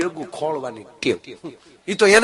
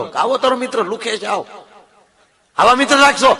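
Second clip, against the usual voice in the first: high, loud scolding wife, rising to a shout; shrill-edged telling-off building up; 7 semitones higher, 6 dB louder than usual. Retold or imitated. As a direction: voice the wife nagging her husband about his friend. Wife scolds husband about friend.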